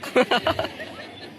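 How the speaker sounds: low laugh under the breath; laughing softly to himself; soft chuckle. Real, not acted. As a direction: chuckle quietly at giving in. Laughs as compounder wins.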